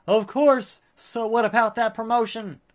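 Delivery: a hint of a slick, self-satisfied manager; Paul Heyman. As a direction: play a pompous promoter handing out praise. Voices Paul Heyman's game dialogue.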